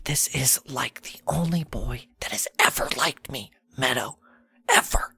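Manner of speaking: pleading whispered protest to a sister; hushed, emphatic complaint. Acted. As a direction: whisper insistently, keep it secret. Whispered argument between sisters continues.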